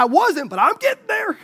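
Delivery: high, loud defensive squawk; mock defensive protest. Husband arguing with his wife over his tone, comically defensive.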